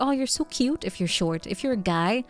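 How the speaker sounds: normal talking voice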